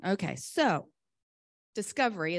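ordinary delivery

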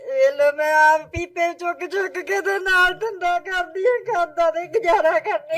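real weeping lament; crying. Real, not acted. Old mother telling visitor of her labour, crying. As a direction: plead your hardship through tears. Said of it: "ਤੁਸੀਂ ਰੋ ਨਾ ਮਾਤਾ ਜੀ".

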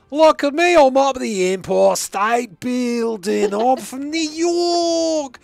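put-on high, shouted New York accent, part Crocodile Dundee; bad New York accent. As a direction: holler like a fake New Yorker. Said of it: "bit Crocodile Dundee".

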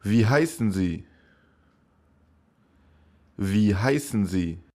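normal talking voice

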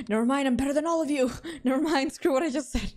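giddy, self-mocking brag; gleeful boasting. Real, not acted. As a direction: crow over the win, breaking into laughter. Sudden boast after finding anomaly.

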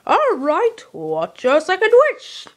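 lightly voiced little leprechaun. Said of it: "You sound like a little leprechaun".

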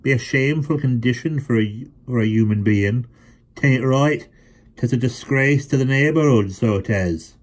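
hammed-up solemn rustic sermon; mock solemn. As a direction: deliver it like a stern village elder.

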